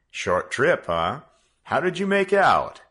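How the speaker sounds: amused fatherly teasing. A narrator's reading. The father teases his son.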